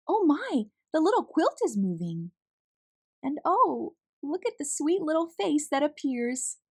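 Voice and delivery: delighted surprise. A narrator's reading. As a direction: marvel at what appears. Narrator marvels at the hamster.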